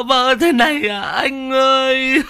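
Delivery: high, loud hammed-up mourning wail; fake wailing. Cúc fake-wailing at the funeral, hammed-up lament. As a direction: wail falsely over the dead brother. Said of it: "cố tình vật vã gào lên gào xuống".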